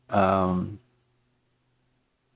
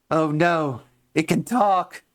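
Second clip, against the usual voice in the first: high alarmed voice; 9 semitones higher than usual. Acted. Voicing character lines.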